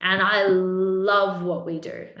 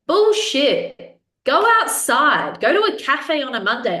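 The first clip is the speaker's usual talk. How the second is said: loud, higher-pitched dismissal, slow punchy stresses; emphatic rebuttal; 5 semitones higher, 5 dB louder, slower than usual. Real, not acted. Coach knocking down a client's excuse, emphatic and loud. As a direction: shoot the excuse down loudly, leaning on each phrase.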